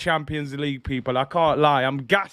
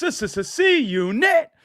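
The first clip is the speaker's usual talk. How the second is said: stuttered build into a high, stretched call; hyped shout-out; 9 semitones higher, slower than usual. Real, not acted. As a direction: draw out the crew's name, pitched way up. Hypes up the fan group's name.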